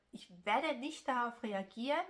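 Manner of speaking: ordinary talk, nothing marked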